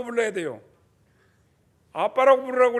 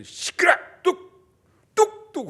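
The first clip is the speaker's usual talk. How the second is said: loud higher snaps of 뚝; harsh scolding; 8 semitones higher, 5 dB louder, slower than usual. Retold or imitated. Preacher imitating a parent silencing a crying baby, loud snaps. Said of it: "눈을 부라리면".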